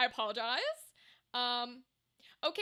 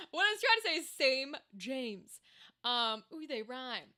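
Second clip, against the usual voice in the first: ordinary talk, nothing marked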